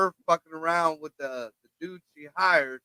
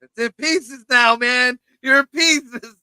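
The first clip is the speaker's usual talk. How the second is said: playful pun; 6 semitones higher, 7 dB louder, slower than usual. Real, not acted. Punning joke, said loudly.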